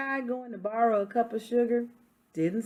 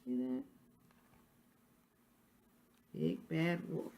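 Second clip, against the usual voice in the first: quiet aside; 8 dB quieter than usual. Real, not acted. Aside showing picture.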